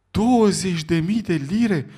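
high, loud, slow disbelieving question; astonished exclamation. A narrator's reading. Sullivan shocked by Fogg's stake, high slow outcry. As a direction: stagger at the sum. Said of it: "exclamă John Sullivan".

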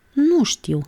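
flustered. A narrator's reading. Monica caught out by her friend's question, flustered. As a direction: get a little tangled up, pitched high. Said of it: "se încurcă Monica".

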